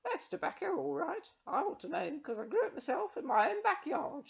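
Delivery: put-on high old crone, boasting; proud old witch. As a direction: show off her home-grown tobacco. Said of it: "she added with pride".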